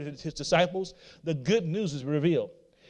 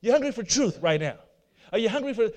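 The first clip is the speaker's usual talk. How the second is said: normal talking voice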